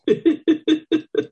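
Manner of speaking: high laughing burst; hearty laugh. A narrator's reading. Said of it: "He laughs".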